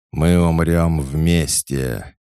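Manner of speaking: loud, slow, rumbling monster voice; inhuman voice. A narrator's reading. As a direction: rumble it out like a fire creature. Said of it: "нечеловеческим голосом пророкотал".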